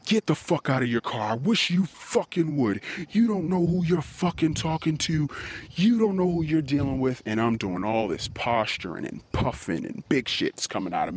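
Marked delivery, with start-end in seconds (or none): 0.0-7.2 raised, rising threats hurled at the driver; threatening yell. Retold or imitated. Furious threats at the honking driver. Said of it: "And I said".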